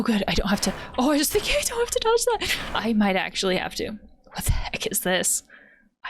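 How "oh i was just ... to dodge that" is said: high, rushed blurt; startled. Real, not acted. Player surprised by an attack to dodge, startled. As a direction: blurt it fast, pitch leaping up. Startled while dodging in game.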